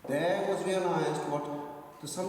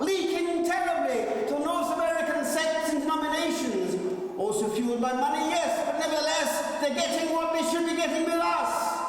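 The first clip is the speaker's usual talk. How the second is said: fiery preaching; 10 semitones higher than usual. Acted. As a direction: thunder it from the pulpit. Preaching to congregation at full force.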